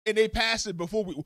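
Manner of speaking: normal talking voice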